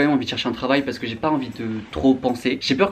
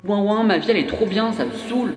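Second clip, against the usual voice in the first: mocking whine; 9 semitones higher than usual. Mocking whiny complainer.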